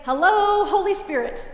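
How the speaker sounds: calling it out loudly; loud demo. Shown as a demonstration. Pastor showing a child how to say it, loud. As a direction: sing it out big and loud to show him. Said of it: "Do it again, loud".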